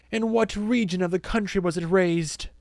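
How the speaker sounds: high, imperious cross-examination; pressing interrogation. A narrator's reading. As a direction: press the witness with theatrical authority. Accuser presses the worker.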